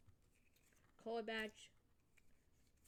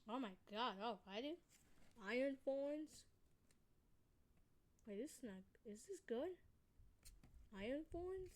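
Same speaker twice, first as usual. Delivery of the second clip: puzzled, pitch swinging up into questions; unsure whether to be amazed, voice rising in disbelief; real surprise; 3 semitones higher than usual. Real, not acted. Card opener unsure if Iron Thorns is good, surprised. Unexpected card pulled from the pack.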